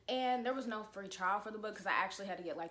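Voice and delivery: ordinary delivery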